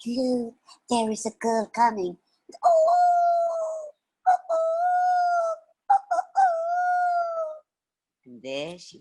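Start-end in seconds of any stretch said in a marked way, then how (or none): 0.1-2.2 higher-pitched fox voice; excited foxes. Acted. Foxes spotting the girl, excited. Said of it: "the foxes said". 2.6-7.6 very high, drawn-out hooting howl; fox howls. Acted. Foxes seeing the girl coming, howling.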